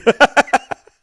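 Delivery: high, loud burst of real laughter. Real, not acted. Host bursts out laughing at a viewer's funny business name, loud high laugh. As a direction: crack up loud and high at the joke.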